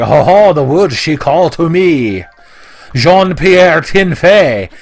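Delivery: put-on booming French Canadian lumberjack accent. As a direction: belt it out like a burly French Canadian woodsman. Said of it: "burly French Canadians".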